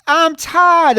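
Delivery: loud, high-pitched hammed-up shriek; mock screaming. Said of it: "They're both screaming".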